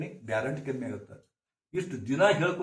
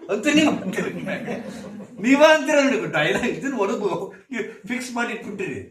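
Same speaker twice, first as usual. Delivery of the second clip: talking through real laughter, high and loud; laughing; 6 semitones higher, 9 dB louder than usual. Real, not acted. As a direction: laugh out the words. Preacher laughs at parents' saying. (ನಗುತ್ತಾ ಹೇಳುವುದು)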